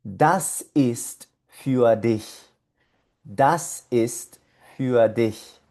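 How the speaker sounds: "Das ist für dich" very slowly, word by word; pauses between words, für said "fü-a"; slow pronunciation model. Shown as a demonstration. Teacher reading the whole expression for learners, slow and separated. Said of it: "toda la expresión se lee".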